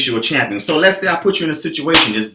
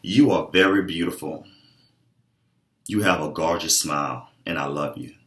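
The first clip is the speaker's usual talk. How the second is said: soft, lower, tender praise; sincere compliment; 10 semitones lower, 6 dB quieter than usual. Shown as a demonstration. Coach sincerely complimenting a client, soft and tender. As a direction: say it gently like you mean every word. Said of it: "I believe it sounds like this".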